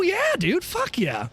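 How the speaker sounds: usual speaking voice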